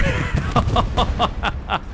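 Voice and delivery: breathy burst of laughter; laughing. Real, not acted. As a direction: laugh out at the play. Laughs at the kill.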